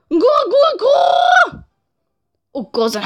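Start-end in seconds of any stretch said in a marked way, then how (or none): 0.1-1.6 very high shouted coo; shrieked pigeon coo. Acted. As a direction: screech like a furious pigeon.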